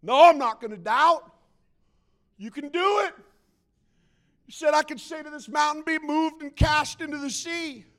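high, loud insistence; acted-out forced confidence in prayer; stubborn believer. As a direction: refuse doubt at full voice. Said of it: "You're like".

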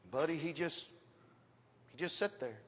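ordinary talk, nothing marked

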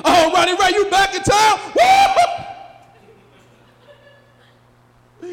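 loud, high, overjoyed buddy greeting; excited friend impression. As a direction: shout it like a thrilled old friend. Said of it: "Their favorite phrase is".

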